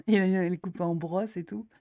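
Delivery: giggling at a funny drawing while talking; words broken up by real laughter; laughing through it. Real, not acted.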